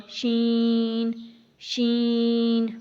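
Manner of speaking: شین pushed through the nose, stretched; wrong way, nasal شین; nasal shin. Shown as a demonstration. Teacher shows the wrong nasal شین. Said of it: "اگر ہم اس کو ناک میں پڑھیں گے تو کیسے ہوگا؟".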